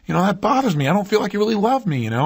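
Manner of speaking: a touch of upset in the retelling; mild upset. Retold or imitated. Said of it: "she finally gets upset about it".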